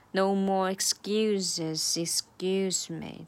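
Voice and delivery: slowly, linking excuses into excuse me; slow linked demo. Shown as a demonstration. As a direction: draw out each word of the run. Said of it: "这是一个三连".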